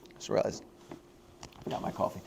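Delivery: whispered aside to himself; sudden whispered aside; muttered aside. Real, not acted. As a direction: drop to a breathy undertone mid-sentence. Aside mid-speech, unvoiced.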